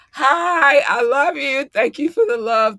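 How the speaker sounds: high, excited affection; gushing greeting. Real, not acted. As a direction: squeal hello to adored fans.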